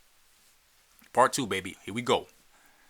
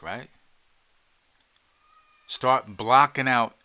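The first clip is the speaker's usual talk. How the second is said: ordinary talk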